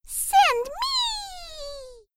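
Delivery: very high squeak; squeaky tiny voice. Acted. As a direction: squeak up from the ceiling. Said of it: "squeaked the tiny voice".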